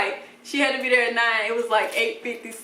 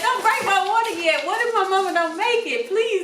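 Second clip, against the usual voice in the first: panicked plea; 9 semitones higher than usual. Retold or imitated. Repeats the plea more urgently.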